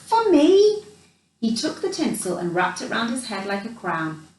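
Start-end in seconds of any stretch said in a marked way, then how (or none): 0.1-0.9 high, louder amazed question; can't believe it's for you; old man amazed at a Christmas present, high and bright; delighted surprise. Acted. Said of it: "asked the old man".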